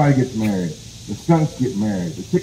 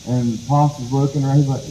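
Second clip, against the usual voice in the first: ordinary delivery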